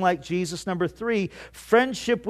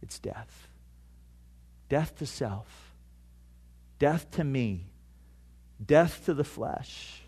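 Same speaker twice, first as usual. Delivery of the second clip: solemn hush; 5 semitones lower, 8 dB quieter than usual. Acted. Solemn litany of death.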